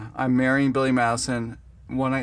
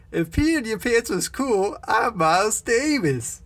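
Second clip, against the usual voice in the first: sing-song it like the goofy character; movie quote voice; 8 semitones higher than usual. Retold or imitated. Teasing friend quoting the film in a higher put-on voice. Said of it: "How many times you've referenced it".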